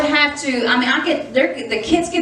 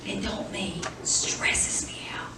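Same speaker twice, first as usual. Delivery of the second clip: much softer, whispered confession; whispered aside; 12 dB quieter, slower than usual. Real, not acted. Preacher whispering to the congregation about husband's TV habit. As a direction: drop to a whisper as if sharing a secret.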